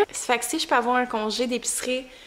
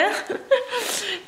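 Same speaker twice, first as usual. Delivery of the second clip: bright laugh; 9 semitones higher, 3 dB quieter than usual. Real, not acted. Laughing at herself.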